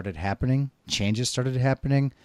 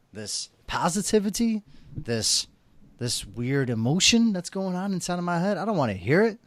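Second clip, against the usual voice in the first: high-pitched, hammed-up protest; play-acted exasperation, starting with a loud burst; mock indignant outburst; 7 semitones higher, 6 dB louder than usual. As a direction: argue back at the voice in your head. Acts out arguing with inner voice.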